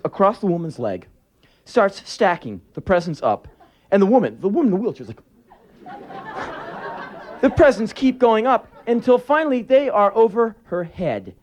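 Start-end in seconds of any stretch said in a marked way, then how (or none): 5.9-7.1 high squeaky laugh. Retold or imitated. Wheelchair woman's reaction.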